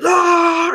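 high, loud "aah" war cry; high-pitched attack scream; battle yell. Acted. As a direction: let out a charging yell mid-sentence. Acting out his charge at monster.

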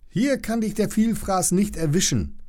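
high, protective reassuring tone. Acted. Lilly reassures the scared long-neck, high and protective. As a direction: comfort the frightened baby.

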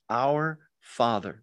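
slow, thoughtful "Our Father"; slow and reverent. Shown as a demonstration. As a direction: say it slowly, weighing each word. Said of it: "do it like this".

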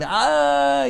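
long, high wailing "ah"; mock scream held on one high note; imitated scream. Acting out a scream to Hashem, drawn-out high cry. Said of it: "he's screaming to Hashem".

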